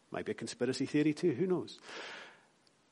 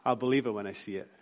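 low, soft sceptical Scot; dry Scottish disbelief, pitched down; Scottish accent; 4 semitones lower, 6 dB quieter than usual. Acted. Preacher imagines Thomas as a Scot, Scottish accent. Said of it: "in a Scottish accent going".

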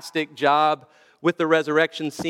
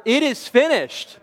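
much higher, insistent stage cry; louder repeated cry; 8 semitones higher than usual. Retold or imitated. Actor Jesus to soldiers who missed their cue, crying out louder. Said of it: "cried out even louder".

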